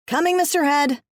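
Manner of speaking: calling back. A narrator's reading. Employee answering her boss in the other room, calling back.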